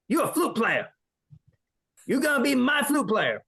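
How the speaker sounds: high, taunting mock drill sergeant; hammed-up sergeant teasing the recruit; sergeant's voice. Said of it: "I'm like".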